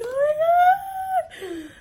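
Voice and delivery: loud, slow, drawn-out high wail; overwhelmed squeal. Retold or imitated. Meeting so many relatives so quickly, loud overwhelmed squeal. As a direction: stretch it into an acted ecstatic squeal.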